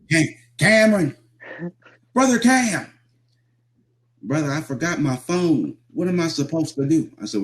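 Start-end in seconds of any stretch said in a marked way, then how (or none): 0.1-2.9 high, loud mock holler for help; imitated frantic calling. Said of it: "you had to hear him". 4.2-7.1 high-pitched mock pleading; mimicked helpless panic. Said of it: "you had to hear him".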